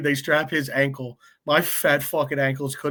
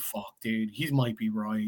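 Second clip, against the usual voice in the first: resigned mutter; 4 semitones lower, 9 dB quieter than usual. Retold or imitated. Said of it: "I'm just like".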